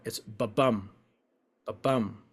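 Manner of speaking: soft "ba-bum", weak then strong beat; heartbeat rhythm. Shown as a demonstration. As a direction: tap it like a heartbeat. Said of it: "the beat of the heart".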